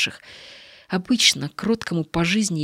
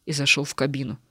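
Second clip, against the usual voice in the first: ordinary delivery, nothing marked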